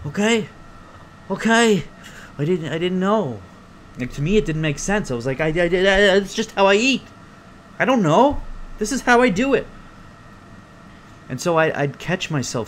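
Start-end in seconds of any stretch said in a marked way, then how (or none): 0.1-3.4 high-pitched flustered protest; raised, hurt-sounding imitation of a kid; defensive child. Child answering furious grandmother. 5.4-9.7 high, loud stammering protest; acted indignant kid, pitched way up; flustered child.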